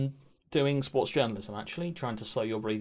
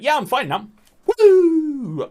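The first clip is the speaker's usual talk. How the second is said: high, loud put-on cheer; fake cheerful; 13 dB louder, much slower than usual. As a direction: whoop it up as if all is fine. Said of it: "I'm not gonna sit here and be like".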